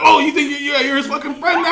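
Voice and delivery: high, loud sarcastic accusation; hammed-up pouncing yell with laughter; mock outraged sergeant. Sergeant to recruit saying the name, mock outrage.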